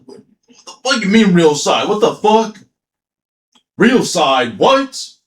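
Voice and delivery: baffled outburst, voice shooting up on the last word; acted incredulity. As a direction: fire back the questions in mock disbelief. Retold baffled reaction to a reveal.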